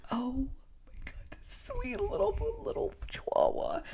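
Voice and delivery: breathy gushing whisper over a cute dog; soft awed whisper. Retold or imitated. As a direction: whisper it, melting over the puppy.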